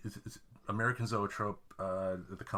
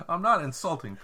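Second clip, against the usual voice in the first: laughing; 8 semitones higher, 4 dB louder than usual. Real, not acted. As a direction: protest through laughter. Laughing protest.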